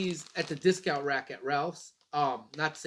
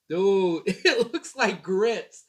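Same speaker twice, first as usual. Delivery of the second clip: laughing; 4 semitones higher, 7 dB louder, much slower than usual. Real, not acted. Laughing at the cereal.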